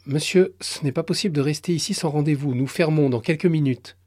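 plus haut et rapide, ferme; guichetière ferme. Acted. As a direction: couper court poliment. (guichetière qui refuse vite)

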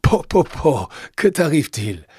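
loud, high, startled grandpa; astonished. A narrator's reading. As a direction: exclaim in surprise at the child. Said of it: "interrogea Joseph d'un air étonné".

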